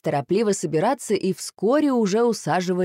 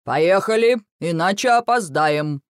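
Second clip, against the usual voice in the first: louder, firm command; 2 semitones higher, 4 dB louder than usual. A narrator's reading. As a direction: order the family off. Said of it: "Папа скомандовал". (командует)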